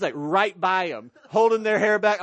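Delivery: ordinary talk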